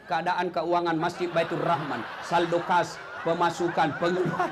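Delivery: low monotone notice reading; droning read-out. Acted. Bored worshipper reading the mosque's finance board, low drone. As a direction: drone through it like a dull wall notice.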